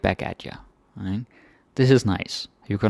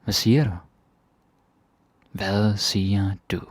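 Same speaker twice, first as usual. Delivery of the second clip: ordinary talk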